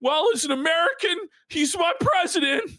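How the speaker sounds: high, whiny imitation of grudging surrender; mock meltdown. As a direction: fake near-tears, voice climbing as you give in. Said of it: "like, melting down".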